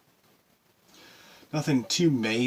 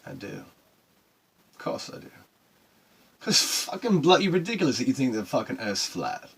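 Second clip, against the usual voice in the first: ordinary delivery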